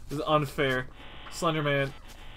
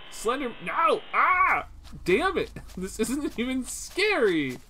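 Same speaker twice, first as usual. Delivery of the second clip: high, exasperated outburst; 6 semitones higher, slower than usual. Real, not acted. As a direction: cry out in startled annoyance at the game. Startled, then annoyed by the game.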